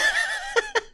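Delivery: squeaky laugh; high giggle. Real, not acted. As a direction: break into a giggle. Laughs at his joke.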